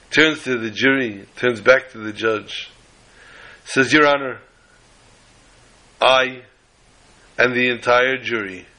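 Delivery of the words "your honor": ordinary delivery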